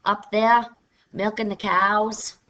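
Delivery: lightly voiced Yankee farmer, slow on the cows; hint of a drawling New England farmer. Shown as a demonstration. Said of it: "we have the New England farmer".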